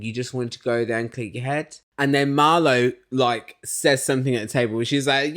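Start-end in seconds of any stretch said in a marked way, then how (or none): none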